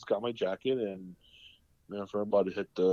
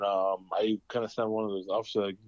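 usual speaking voice

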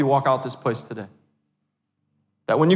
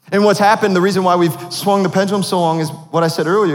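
loud, lifted preaching build; 4 semitones higher, 4 dB louder than usual. Acted. Pastor preaching to the church, loud and lifted. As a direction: drive the point home to the congregation.

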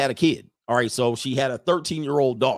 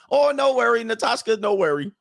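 high-pitched mock foreign girlfriend accent; Natasha's accent; 9 semitones higher, 3 dB louder than usual. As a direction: wave it away in her squeaky-high imitated accent. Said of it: "in her accent".

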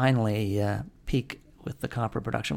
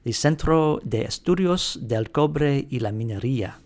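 Spanish accent; 3 semitones higher, 5 dB louder than usual. Shown as a demonstration. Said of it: "here's my Spanish accent".

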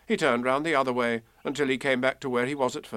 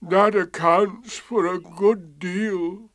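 slow, higher, mournful donkey; gloomy; 7 semitones higher, 4 dB louder, slower than usual. A narrator's reading. Eeyore learning his tail is gone, gloomy. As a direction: drag the words out dismally. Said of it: "said Eeyore gloomily".

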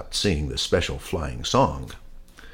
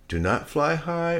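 teacher pig voice; 11 semitones higher than usual. Acted.

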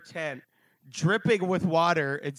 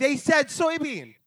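excited disbelief; 10 semitones higher, 4 dB louder than usual. Retold or imitated. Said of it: "me and you were like".

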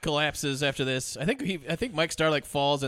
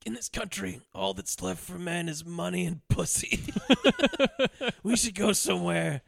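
put-on incoherent drunk ramble; 3 semitones higher than usual. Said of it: "almost completely incoherent speech".

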